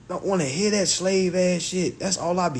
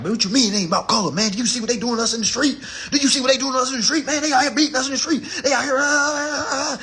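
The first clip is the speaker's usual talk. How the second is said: fast, high, louder mimicry of outraged folks; agitated protest; 7 semitones higher, 3 dB louder, faster than usual. Folks objecting to him about the street, agitated protest. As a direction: rattle it off high, trailing into babble.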